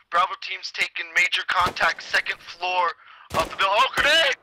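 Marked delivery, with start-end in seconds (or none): 3.7-4.3 sudden high warning yell; alarmed shout. Real, not acted.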